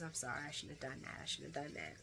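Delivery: very soft, low apology; hushed, low retold sorry; imitating an apology. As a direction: drop to a contrite murmur. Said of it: "And then he apologizes".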